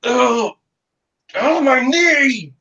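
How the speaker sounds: high, slow wailing over the knee; pained groan. Acted. Wounded marshal moaning to Russet, high and pained. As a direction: whine like a wounded man hamming it up.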